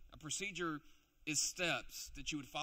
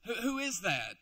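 louder, curious call; 5 semitones higher, 6 dB louder than usual. Acted. Beggar hears a commotion.

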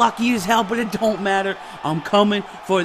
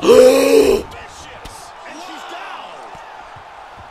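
loud gasp; 4 semitones higher, 4 dB louder than usual. Real, not acted.

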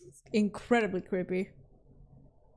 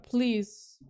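usual speaking voice

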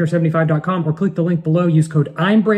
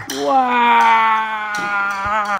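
ordinary delivery

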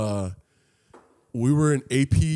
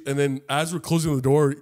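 usual speaking voice

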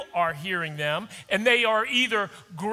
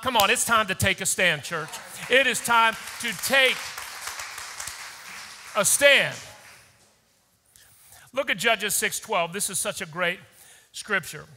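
normal talking voice

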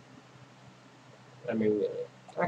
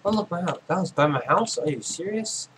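higher, louder disbelief at finding the house; stunned that it was right by his house; surprised; 7 semitones higher, 4 dB louder than usual. Real, not acted. Gamer surprised to find his own house nearby, disbelieving.

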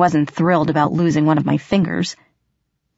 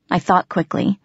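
ordinary delivery

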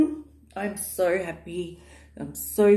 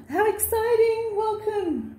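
much higher, squealing with delight; excited squeal; 13 semitones higher than usual. Real, not acted. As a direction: greet them bursting with excitement. Excited greeting to viewers.

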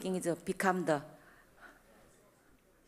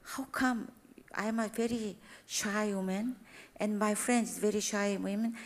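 ordinary delivery

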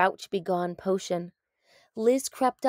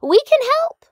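much higher, louder eager call; eager cry; 13 dB louder than usual. A narrator's reading. Lizard eagerly offering help, crying out high. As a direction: shout out to volunteer. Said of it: "cried Liz".

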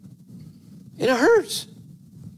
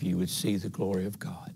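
hushed; 6 semitones lower, 9 dB quieter than usual. Acted. Jesus gently rebuking Martha.

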